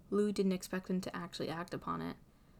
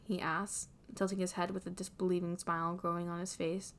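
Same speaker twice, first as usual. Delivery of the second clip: ordinary delivery, nothing marked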